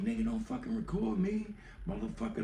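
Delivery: high mock girl voice; hammed-up furious girl voice; imitating angry girl. Girl yells at him.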